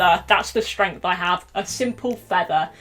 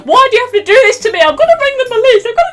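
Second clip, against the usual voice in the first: very high, loud hysterical shouting; hammed-up frantic protest, pitched right up; frantic yelling; 12 semitones higher, 10 dB louder than usual. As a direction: shriek after a thief like a helpless tattletale. Acting out yelling after the thief.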